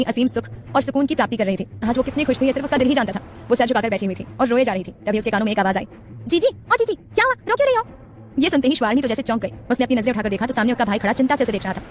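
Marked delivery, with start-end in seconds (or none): 6.3-7.8 put-on high-pitched child's voice calling out; concerned little brother. A narrator's reading. Brother finds his sister crying, concerned little-boy voice. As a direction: play a worried kid, pitched way up.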